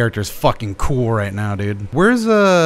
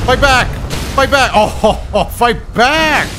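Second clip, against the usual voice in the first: gleeful taunting; 11 semitones higher, slower than usual. Real, not acted. Gamer taunting a titan that cannot fight back, gleeful mock. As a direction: goad the helpless giant, laughing.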